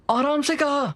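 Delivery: high, hurting complaint; yelped reproach, pitched high; pained protest. Acted. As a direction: insist through the pain that they go gently. Hurt, repeats request to go gently.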